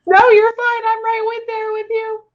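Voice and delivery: high, teary voice holding it together; choked-up high comforting; crying through words. Real, not acted. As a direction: reassure her while welling up. Crying reading, comforting sitter.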